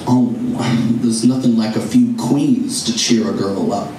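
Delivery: Mae West voice. Retold or imitated. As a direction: lightly purr it like a tipsy Mae West. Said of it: "in this Mae West voice".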